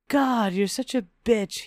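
acted angry outburst, not full volume; angry yell. A narrator's reading. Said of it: "he yelled at me".

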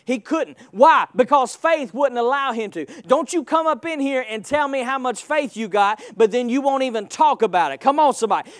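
usual speaking voice

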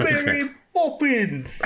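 high, slow, laughing Mary Poppins voice; hammed-up British nanny; mock posh British. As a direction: trill it like a prim English nanny. Mocking over-the-top British.

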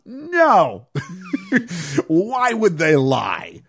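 loud high sarcastic no, slow question; sarcastic scoff at the plot. Real, not acted. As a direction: drip sarcasm.

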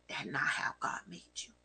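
soft voiceless correction; hushed whispered rebuke. Acted. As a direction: lean in and whisper it gently. Softly correcting the stubborn person.

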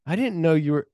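high-pitched put-on backpedalling; squeaky acted denial; flustered mock protest. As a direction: imitate someone caught out, voice shooting up.

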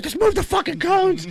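very high comic shout; mock frantic yell. Fallen co-worker yells about the cones, high mock shout. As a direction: yell it in high-pitched exasperation. Imitating co-worker yelling after falling.

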